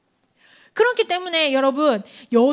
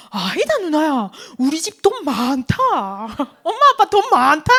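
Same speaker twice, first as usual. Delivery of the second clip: younger sibling; 5 dB louder than usual. Retold or imitated. Little brother insists to his sister the family is rich, cocksure and loud. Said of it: "내 동생이 뭐라면".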